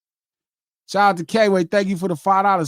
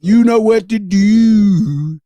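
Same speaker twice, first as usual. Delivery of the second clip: slow, louder Baltimore drawl; Baltimore accent; 8 dB louder, much slower than usual. Retold or imitated. Host imitating how Baltimore people talk, drawn-out accent. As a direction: drag out every word, Baltimore-style. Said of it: "That's how all the Baltimore people talk".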